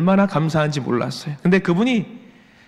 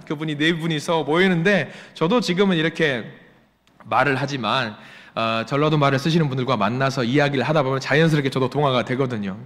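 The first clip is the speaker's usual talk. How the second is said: ordinary talk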